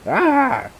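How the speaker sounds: high, loud startled cry; startled scream. Acted. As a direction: shriek as if jumped at. Character startled by Morgan.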